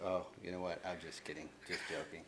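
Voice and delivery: low, soft mock retreat; quietly dropping the bravado; sheepish backing down. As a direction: deflate and brush it off under your breath. Show-off walks away backing down.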